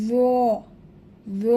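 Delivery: জ as a thick, buzzed "dho" held one beat; drawn-out letter name. Shown as a demonstration. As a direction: stretch জ with tongue tip at the teeth. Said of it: "এক আলিফ টেনে". (জ এক আলিফ টেনে মোটা করে)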